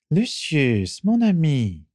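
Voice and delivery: lightly voiced dark lord, pleased; a dark lord greeting his friend, lifted pitch; Voldemort voice. A narrator's reading.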